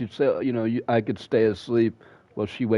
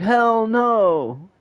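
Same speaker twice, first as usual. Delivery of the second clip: emphatic refusal; 11 semitones higher, 5 dB louder than usual. Retold or imitated. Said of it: "And I was like".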